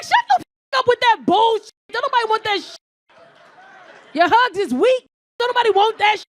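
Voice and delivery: high, loud mock rant; explosive shouted rejection; acted furious outburst. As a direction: tear into him at full volume. Acted quarrel, snapping at boyfriend.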